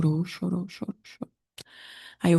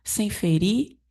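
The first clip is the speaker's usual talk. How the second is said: preaching; 6 semitones higher than usual. Acted. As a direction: build each "exige" like a sermon. Pregação em tom elevado. (pregação enfática e lenta)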